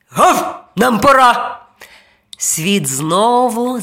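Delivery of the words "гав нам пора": short barky burst; punchy talking-dog call; barking dog. A narrator's reading. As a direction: announce it like an eager dog. Dog barks it is time.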